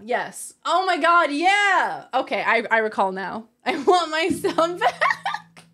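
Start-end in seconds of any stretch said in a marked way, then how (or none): 3.6-5.6 slow, high, laughing wail; laughing mock plea. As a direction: beg dramatically through laughter.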